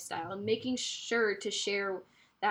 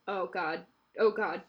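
ordinary talk, nothing marked

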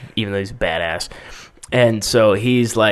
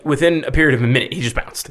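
usual speaking voice

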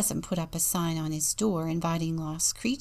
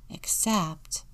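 usual speaking voice

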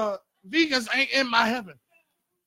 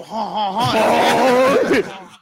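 mock French laugh; 8 dB louder, much slower than usual. Co-host coaching a French accent, loud mock French laugh. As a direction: belt out a hammed-up French laugh. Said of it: "with a French accent".

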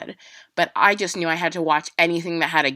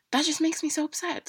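high, softer, on the edge of tears; real tearful upset, higher and softer; voice breaking; 11 semitones higher, 5 dB quieter than usual. Real, not acted. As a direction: let the voice crack as the feeling rises. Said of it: "Oh my God, I'm getting emotional".

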